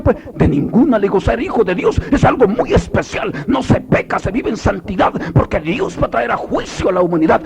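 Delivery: high, loud, driving sermon; impassioned preacher's shout; fiery preaching. Real, not acted. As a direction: proclaim it to the congregation at full force. Preaches at full force.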